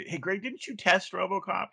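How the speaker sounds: high, light voice calling over a colleague; acted-out high-pitched question; imitated call to coworker. Asking coworker across the office.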